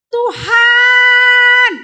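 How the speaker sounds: loud, high anguished cry of Tuhan. Acted. Dying king cries to God, loud weeping cry. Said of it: "dia pakai nangis".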